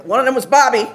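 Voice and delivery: ordinary talk, nothing marked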